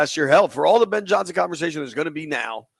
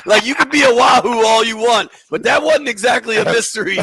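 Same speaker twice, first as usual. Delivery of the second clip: higher, louder, laughing tease; amused teasing; 5 semitones higher, 5 dB louder than usual. Real, not acted. Host ribbing a caller over his alma mater, laughing. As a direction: crack up while ribbing him.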